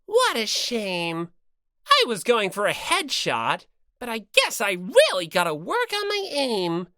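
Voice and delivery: low smug mascot. Acted. Second character after firing crossbow.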